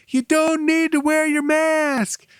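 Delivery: high, slow, stretched-out call; imitated calling out. Owner to customer down the sidewalk, high imitated call.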